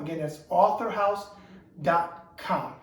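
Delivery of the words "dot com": ordinary talk, nothing marked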